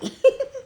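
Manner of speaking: high short laugh; amused laugh. Real, not acted. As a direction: laugh fondly at the memory. Laughs at grandson's remark.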